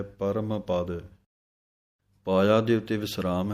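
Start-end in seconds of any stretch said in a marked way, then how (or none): 0.0-1.0 ਪਰਮ with a full, sounded ਮ before ਪਦ; full ਮੰਮਾ sound. Shown as a demonstration. Teacher shows students the full ਮ in ਪਰਮ ਪਦ. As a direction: close ਪਰਮ on a clear ਮ. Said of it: "ਮੰਮੇ ਦੀ ਪੂਰੀ ਆਵਾਜ਼ ਦੇਣੀ ਹੈ".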